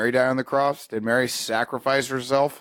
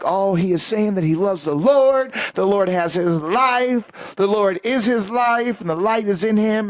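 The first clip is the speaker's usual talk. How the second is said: imitating an awed congregation, higher, drawn out; mock religious awe; 6 semitones higher than usual. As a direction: marvel like a swept-up believer. Congregation marvelling at the convert.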